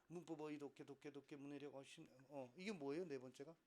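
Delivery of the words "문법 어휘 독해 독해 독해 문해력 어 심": quiet, run-together word reading; skimming read. Shown as a demonstration. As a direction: skim "문법 어휘" in a quick undertone. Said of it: "누가 이렇게 읽어요".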